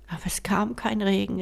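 plain reading voice

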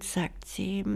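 ordinary delivery

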